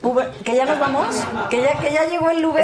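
teasing question through laughter; laughing joke. Real, not acted. Guest jokes with hosts about leaving, laughing. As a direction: laugh while asking if the ride is here. Jokes that they must leave.

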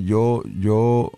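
normal talking voice

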